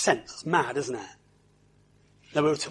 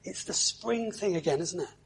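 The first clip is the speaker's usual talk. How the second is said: normal talking voice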